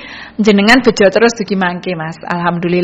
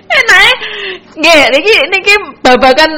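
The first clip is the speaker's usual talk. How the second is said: squealing the words through laughter; laughing; 11 semitones higher than usual. Real, not acted. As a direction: talk while still cracking up. DJ giggling about sambel terasi.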